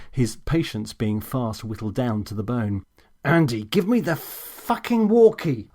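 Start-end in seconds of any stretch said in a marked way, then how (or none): 3.2-5.7 much higher, louder, slow furious order; snapping at the assistant, a furious command; angry shout. A narrator's reading. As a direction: bark it like a stormtrooper. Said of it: "I shouted".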